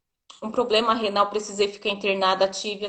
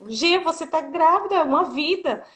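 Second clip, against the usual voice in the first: higher, lightly voiced exasperated friend; astonished friend; 7 semitones higher than usual. Friend finds Gi crying over a pregnancy test, higher mock disbelief. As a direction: play the friend who can't believe it.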